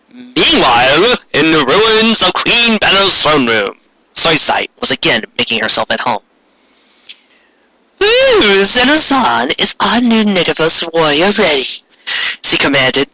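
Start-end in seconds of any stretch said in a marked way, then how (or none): 0.1-3.8 dramatic slow narration. A narrator's reading. Ominous scene change narration. 8.0-11.8 high, shrieking villain opener; villainess voice. Acted. Villain Soisai commanding.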